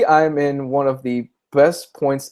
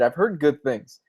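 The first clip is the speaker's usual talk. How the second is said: ordinary talk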